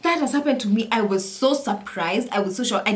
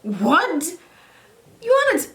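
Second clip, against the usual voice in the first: high, incredulous squeal; high-pitched what-are-you-saying reaction; mock disbelief; 8 semitones higher, 3 dB louder, slower than usual. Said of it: "I'm like".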